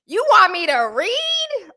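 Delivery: high, drawn-out mock rapper voice. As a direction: imitate a rapper puzzled by reading. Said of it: "she sounded like [name]".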